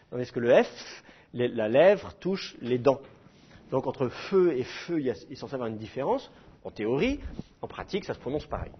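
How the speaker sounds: ordinary delivery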